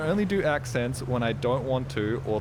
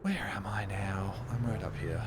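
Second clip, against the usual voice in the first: whispering; 3 semitones lower, 6 dB quieter than usual. Real, not acted. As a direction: whisper it, a little lost. Whispers while lost in game.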